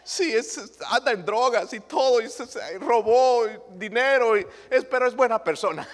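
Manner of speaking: high, laughing mockery of a parent excusing a son; a silly high parent defending a bad son, laughing; mock doting parent. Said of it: "gente diciendo unas barbaridades".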